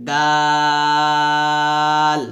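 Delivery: দাল with a light D and long, level "aa"; long-drawn, thin দাল; thin soft D. Shown as a demonstration. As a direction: stretch দাল out evenly. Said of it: "পাতলা করে উচ্চারণ করব".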